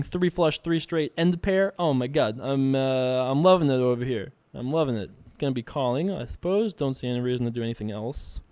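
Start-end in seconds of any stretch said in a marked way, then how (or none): none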